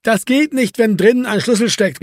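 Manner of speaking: high, exasperated call. A narrator's reading. Erwin objecting to Marco through the window, calling out. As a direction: protest loudly through the door. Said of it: "rief Erwin".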